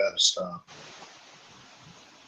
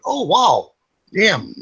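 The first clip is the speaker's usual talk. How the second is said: mock shocked; 8 semitones higher, much slower than usual. Seeing aged classmate.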